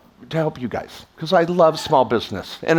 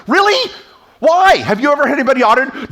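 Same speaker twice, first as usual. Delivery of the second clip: much higher, louder mock disbelief; incredulous retort; 7 semitones higher, 4 dB louder than usual. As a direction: fire back in disbelief, then rush the question. Retorting to worried accountant.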